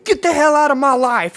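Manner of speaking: put-on furious girlfriend screaming him out of her life; retold scream. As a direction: belt it like she's screaming in your face. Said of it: "this terrifying fucking scream at me".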